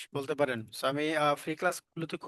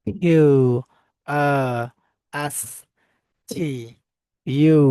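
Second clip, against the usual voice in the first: each letter called out loud and separate; loud alphabet recital; 11 dB louder, much slower than usual. Shown as a demonstration. Teacher drilling students on the alphabet, loud letter-by-letter recital.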